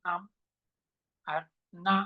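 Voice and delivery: ordinary delivery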